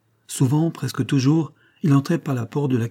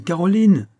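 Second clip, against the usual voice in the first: high, louder second call; crying out louder; 8 semitones higher, 6 dB louder than usual. Acted. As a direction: shout her name again, more urgent. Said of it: "s'écria Hortense".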